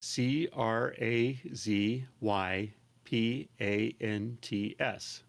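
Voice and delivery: slow, letter-by-letter spelling; measured deadpan spelling. Shown as a demonstration. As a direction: spell it slowly, each letter separate. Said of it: "Can you spell that for me?".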